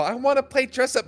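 high teasing mimicry of a little girl; taunting friend by voicing him as a girl, lightly high; mock girly voice. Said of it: "Michael is a girl".

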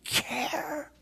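slow heartbroken plea; pleading parent. Acted. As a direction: stretch it out as a grieving parent pleading. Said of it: "jump up and down ... and say".

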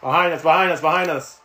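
shouting a warning, loud and high; urgent shout. Real, not acted. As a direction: yell it fast to warn the squad. Enemy behind, warning teammate.

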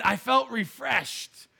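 slow, savoring each word; drawn-out quote. Retold or imitated. Retelling wife's verdict on the ride, slow and savoring. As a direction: stretch it out like a punchline. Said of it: "But she said".

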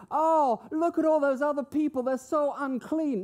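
high, loud, exaggerated horror at others; mock self-righteous disdain. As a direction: play an appalled finger-pointer, pitched high. Mock self-righteous reaction, then denied.

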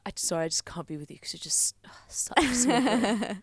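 whispered mock apology, disgust building on "ugh"; mock breakup whisper. Co-host imagines the ex breaking up, mock whisper. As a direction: whisper a fake breakup line, then sneer. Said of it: "That was like".

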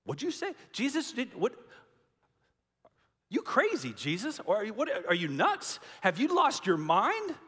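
high, disbelieving outburst; mock the disciples gasping at a crazy idea; hammed-up shocked disciples; incredulous disbelief. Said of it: "the disciples are like".

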